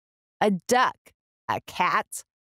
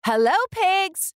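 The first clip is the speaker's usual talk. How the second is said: much higher, bright little duck greeting; cheerful duck voice; 11 semitones higher than usual. A narrator's reading. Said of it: "said Duck".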